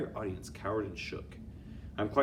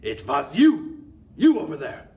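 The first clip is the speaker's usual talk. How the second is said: furious accusation; 7 semitones higher, 14 dB louder than usual. Acted. Grand High Witch accusing the culprit, furious and loud. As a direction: point her out in fury.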